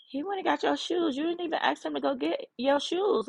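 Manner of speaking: high, moved voice of a crying friend; retelling a friend who is touched and in tears; tearful friend. Retold or imitated. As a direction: imitate her choked-up amazement. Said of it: "And she said".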